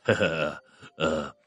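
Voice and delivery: low, deliberate mock laugh to ease the mood; forced chuckle. A narrator's reading. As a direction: fake a friendly chuckle, low. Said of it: "还故意笑了这么几声". (低沉地故意干笑几声)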